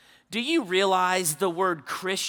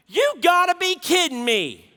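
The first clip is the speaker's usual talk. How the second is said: frustrated daughter; 9 semitones higher, 5 dB louder than usual. Retold or imitated. Daughter's frustrated cry repeated.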